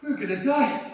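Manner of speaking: rising to a loud fearful cry; terrified. Acted. Trapped man fearing he will be buried alive, terrified. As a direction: voice climbing in terror.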